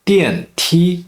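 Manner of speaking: 电梯 with 电 falling sharply, 梯 high and level; fourth then first tone. Shown as a demonstration. Teacher demonstrating mixed tones, 电梯 falling then high-level. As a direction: drop 电, then hold 梯 high. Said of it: "Fourth tone, then first tone".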